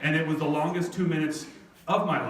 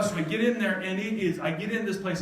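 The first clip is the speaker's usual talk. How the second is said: usual speaking voice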